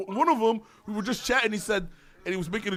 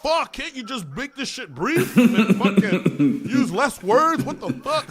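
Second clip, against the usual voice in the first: mock complaint; 4 semitones higher, 5 dB louder, slower than usual. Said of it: "they're gonna complain about".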